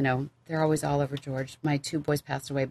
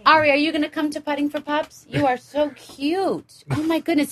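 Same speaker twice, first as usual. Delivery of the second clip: much higher, cooing puppy voice; 11 semitones higher, 5 dB louder than usual. Real, not acted. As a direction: gush over the puppy sweetly.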